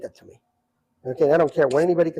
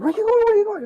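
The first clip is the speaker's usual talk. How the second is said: surprised call; 4 dB louder than usual. Retold or imitated. Jay Leno calls after him.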